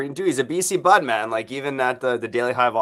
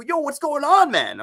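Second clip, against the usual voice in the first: much higher and louder startled yo; mock surprise; 4 dB louder, slower than usual. Host realizing his subscriptions piled up, mock alarm. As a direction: jump up in pitch like caught off guard. Quoting himself in ad.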